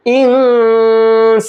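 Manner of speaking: slow Quranic chant, nun hidden in the nose as in इंसानु; tajweed recitation, nasalised nun; recited with nasal hiding. Shown as a demonstration. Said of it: "इस तरह से".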